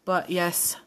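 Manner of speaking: ordinary talk, nothing marked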